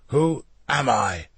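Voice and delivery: slow, throaty prisoner's demand; menacing demand. A narrator's reading. Prisoner demands Leonardo name him, slow and menacing. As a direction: drag the question out with menace. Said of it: "he demanded".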